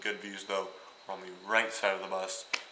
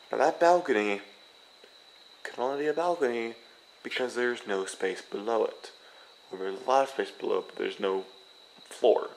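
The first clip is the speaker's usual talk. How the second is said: louder, punchy, with no and floor hit hard; emphatic explaining; 6 dB louder than usual. Real, not acted. Showing viewers the balcony, emphatic and loud.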